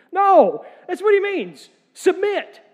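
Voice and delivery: loud, high, sharp No; emphatic shout. Real, not acted. Pastor rebuffing veiled readings, loud high shout. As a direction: cut off the doubt hard. Pastor answers his rhetorical question.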